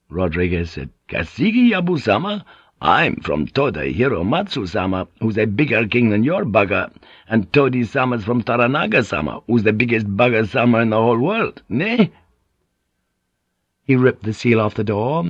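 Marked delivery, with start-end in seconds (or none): 1.1-2.4 much higher, incredulous question; scornful echo. Acted. Pilot challenging the samurai over whose lord is bigger, scornful. 2.8-12.1 animated, swooping foreign-sailor bluster; out-rank them with swaggering bravado; blustering Portuguese pilot. Acted. Pilot telling samurai whose lord is bigger, blustering.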